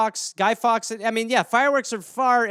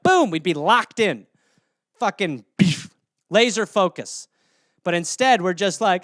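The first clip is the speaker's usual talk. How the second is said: normal talking voice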